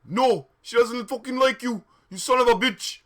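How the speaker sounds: loud, high insult; angry yell. Acted. Draco cursing his rival over a girl, angry yell. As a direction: yell it in hammed-up fury. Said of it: "yelled Draco".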